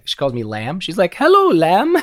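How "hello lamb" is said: put-on high, louder sweet old mother's hello; mom's voice. Son imitating his mother greeting him at the care unit. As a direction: imitate his little Polish mom greeting her son. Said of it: "this little Polish woman with this really heavy accent".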